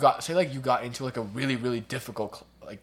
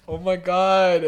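higher, louder, slow dramatic whine; whiny grade bragger; 7 semitones higher, 9 dB louder, slower than usual. Retold or imitated. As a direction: wail over a trivial loss. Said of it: "they'll be like".

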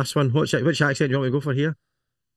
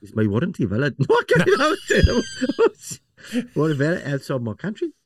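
laughing through a higher put-on South African accent; mock South African accent; 6 semitones higher than usual. Host trying a South African accent for the question, cracking up. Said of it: "Can you do it in South African?".

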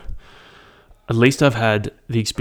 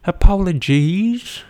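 high, drawn-out, louder apology; mock apology; 9 semitones higher, 5 dB louder, much slower than usual. Host apologising to listeners for crude bit, high mock-sorry voice. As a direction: stretch the word high in playful contrition.

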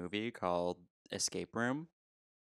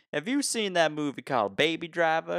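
imitating an elderly Georgia man, higher pitched; down-home Georgia accent; 7 semitones higher, 4 dB louder than usual. Driver imitating elderly passenger's question, Georgia accent. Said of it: "super down homey from, like, Georgia".

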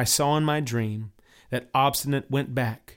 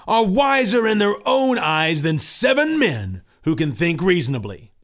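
loud, scornful demand; stubborn Obstinate; 5 semitones higher, 6 dB louder than usual. Acted. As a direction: bark him down, louder.

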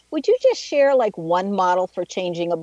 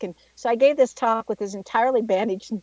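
usual speaking voice

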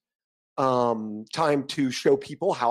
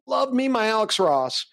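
normal talking voice

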